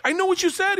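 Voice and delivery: high, eager and chuffed; proud excitement. Retold or imitated. Student answering gangster, proud he understood. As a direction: show off that you understood.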